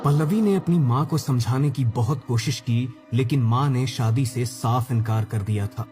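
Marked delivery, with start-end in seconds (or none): none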